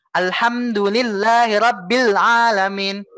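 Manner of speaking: slow, flowing Quran chant; flowing recitation. Shown as a demonstration. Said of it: "itu juga sudah mengalir".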